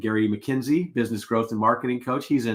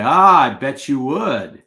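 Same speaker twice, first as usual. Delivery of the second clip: slow, louder knowing tease; teasing customer; 8 dB louder, slower than usual. Retold or imitated. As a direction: drawl it back with a grin.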